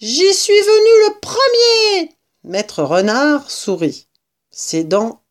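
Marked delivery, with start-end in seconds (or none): 0.0-2.2 high, slow, loud rat squeal; vociferating rat. A narrator's reading. Rat claiming his domain, high drawn-out yell. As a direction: screech each claim out long. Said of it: "vociférait le rat".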